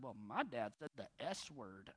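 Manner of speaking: lightly voiced child blurting out a secret; tattling kid. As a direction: mimic the kid telling on dad.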